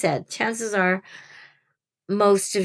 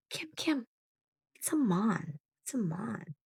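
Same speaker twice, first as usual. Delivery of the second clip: much softer, like a confused aside; hushed quote; 3 semitones higher, 12 dB quieter than usual. Retold or imitated. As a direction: imitate newcomers asking quietly. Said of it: "some of the new people were saying".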